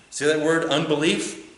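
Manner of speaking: ordinary talk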